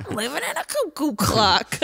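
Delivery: high-pitched cartoon-dad impression; put-on high voice, amused quoting; Homer. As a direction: mimic a cartoon character's exclamation. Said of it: "one of my favorite lines is Homer".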